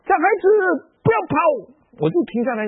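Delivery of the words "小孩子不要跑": high, loud call. Retold or imitated. Old woman calling to the departing boy, a loud call. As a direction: call the boy back sharply. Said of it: "她就马上喊了".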